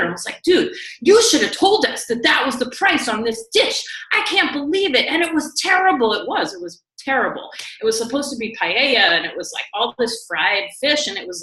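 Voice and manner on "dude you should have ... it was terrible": higher, retold husband's outburst at the waiter; mock angry rant. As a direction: play the husband scolding the waiter, half-serious. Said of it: "got really pissed off and was".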